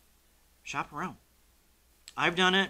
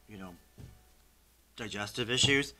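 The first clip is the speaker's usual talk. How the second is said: ordinary delivery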